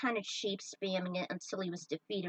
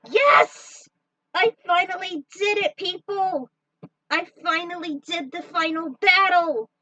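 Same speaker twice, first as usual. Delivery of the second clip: shouting with joy, much higher and louder; triumphant cheer; 9 semitones higher, 10 dB louder than usual. Real, not acted. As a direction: yell out the win to the viewers. Triumphant after beating final battle.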